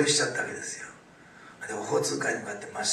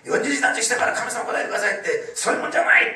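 loud, high-pitched imitation of a formidable scolding; forceful rebuke; 10 semitones higher, 8 dB louder than usual. Fasting expert rebuking boastful fasters, forceful mock scolding. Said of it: "ああいう人はとなく迫力ありますね".

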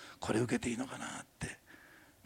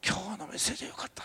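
breathy retold praise in a whisper; whispered quote; 7 semitones higher, 4 dB quieter, faster than usual. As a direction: whisper their words as if repeating them. Retelling praise from a couple. (ささやき声で引用)

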